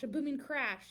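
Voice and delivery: ordinary delivery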